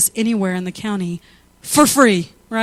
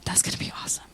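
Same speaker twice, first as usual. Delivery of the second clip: whisper; 11 dB quieter, much faster than usual. Real, not acted. Aside whispered to herself.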